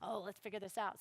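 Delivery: low, hushed argument with himself; soft acted inner voice, torn; inner struggle. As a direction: mull it over darkly under your breath. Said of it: "in his head, he's fighting this demon".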